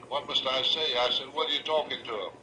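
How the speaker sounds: normal talking voice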